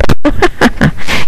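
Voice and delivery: airy laugh right after the threat; a short gloating laugh; teasing breathy laugh. Real, not acted. Laughs after 'never let him out'.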